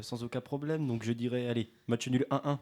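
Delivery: ordinary delivery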